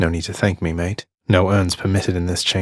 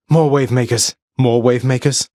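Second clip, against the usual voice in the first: higher, louder warning; alarmed callout; 6 semitones higher, 5 dB louder than usual. Acted. Hero warning of more wave makers. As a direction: shout a warning at the new wave.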